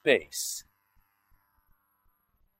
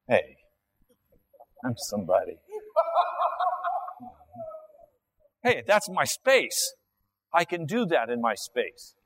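smug churchgoer; 4 semitones higher than usual. Acted. Careless churchgoer voiced.

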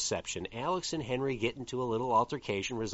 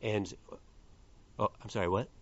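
ordinary talk, nothing marked

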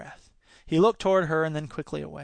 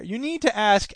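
ordinary delivery

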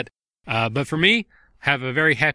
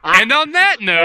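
giddy; 10 semitones higher, 9 dB louder, slower than usual. Real, not acted. Giddy, loud, drawn-out sign-off.

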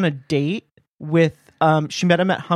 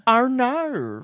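high, exaggerated Australian "our nar"; Australian accent; 7 semitones higher than usual. Shown as a demonstration. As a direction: stretch the vowels in mock Australian. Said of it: "But they say".

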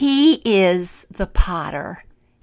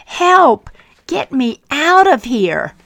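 much higher, much louder shout; yelling for help; 7 semitones higher, 10 dB louder than usual. Acted. Clay trapped in the hot oven, shouting for help. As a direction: scream to be let out. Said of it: "I yelled and knocked".

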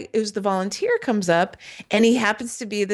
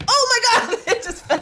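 laughing. Real, not acted. Something falls, laughing.

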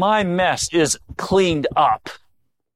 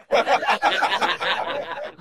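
a soft, high little chuckle; short amused laugh; 6 semitones higher, 4 dB quieter than usual. Real, not acted. Preacher laughing at his own radio joke, soft chuckle. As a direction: laugh quietly at your own punchline.

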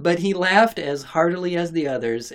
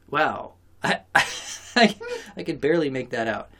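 ordinary delivery

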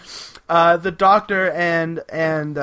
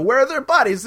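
higher-pitched mock exasperation; exasperated; 7 semitones higher, faster than usual. Zaroff pressing the priest for the bodies, mock exasperated. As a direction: demand it as an impatient villain would.

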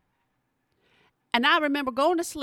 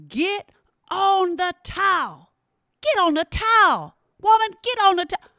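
loud, high mock-husband bark; hammed-up husband yelling orders; 8 semitones higher, 6 dB louder than usual. Mimicking her husband yelling at her during labor, loud mock shout. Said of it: "he yells at me".

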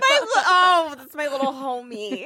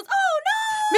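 much higher, falsetto Mister Bill wail; imitating the claymation cry of distress; lightly voiced high cartoon whimper; Mister Bill imitation. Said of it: "and it just goes".

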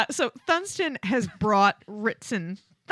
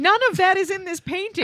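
high-pitched exasperated objection; raised, incredulous pushback; mock protest; 8 semitones higher, 3 dB louder than usual. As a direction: protest the absurd charge. Mock protest at absurd accusations.

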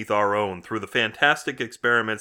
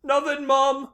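put-on high, guilty "nothing" from a boy; innocent son; 13 semitones higher, slower than usual. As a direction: cover up like a caught kid, pitched high. Son answers the parent.

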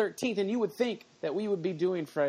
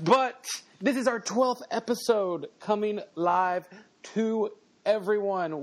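ordinary delivery

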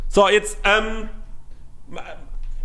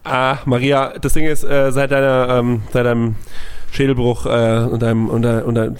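playing Bernd; 7 semitones lower than usual. Spielt Bernd.

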